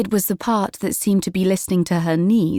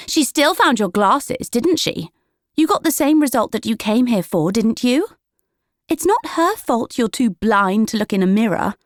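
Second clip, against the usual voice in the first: higher, pointed scolding voice; relentless older sister scolding; sharp sister's retort; 6 semitones higher than usual. A narrator's reading. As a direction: cut her down to size. Juniper berating Prudence.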